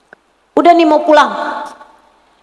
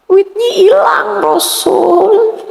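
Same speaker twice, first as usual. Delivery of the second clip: put-on high, tearful little girl's voice; crying girl; 7 semitones higher, slower than usual. Slave girl telling the Prophet her money is lost, high and tearful. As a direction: plead like a small crying girl.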